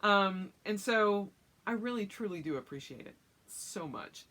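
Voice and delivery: normal talking voice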